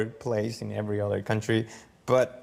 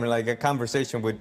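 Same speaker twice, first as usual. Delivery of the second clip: ordinary talk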